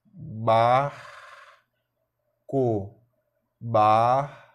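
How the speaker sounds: "Barco" pulled apart as a long loud bar, then co; syllable by syllable. Shown as a demonstration. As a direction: say "barco" in two separated beats. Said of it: "Bora fazer a separação silábica".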